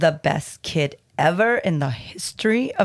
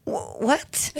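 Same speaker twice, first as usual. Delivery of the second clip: baffled whisper; 6 semitones higher than usual. Acted. Reader baffled by the text.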